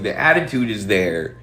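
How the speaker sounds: usual speaking voice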